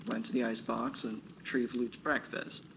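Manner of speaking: plain reading voice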